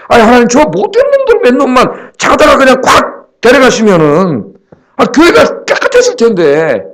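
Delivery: high-pitched retold complaint to God; exasperated plea. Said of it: "제 생각에 그런 생각을 해봤어요". (하나님께 따지듯 높은 목소리로 하소연)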